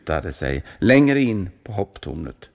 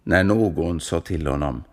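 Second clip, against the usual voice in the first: ordinary delivery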